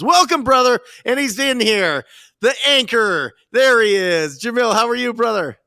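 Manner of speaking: loud, high excited welcome; loud sharp voice. Real, not acted. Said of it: "We're excited".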